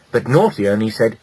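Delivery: plain reading voice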